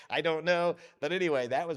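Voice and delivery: laughing. Real, not acted. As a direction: say it through the tail of a laugh. Still laughing at own aside.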